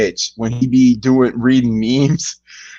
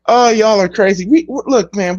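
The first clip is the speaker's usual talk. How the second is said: usual speaking voice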